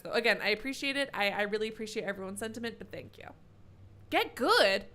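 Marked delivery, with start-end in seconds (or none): 4.1-4.8 loud, high, hammed-up sarcastic rant; sarcastic mock outrage. Streamer mocking a viewer's "get good" advice with loud sarcastic outrage.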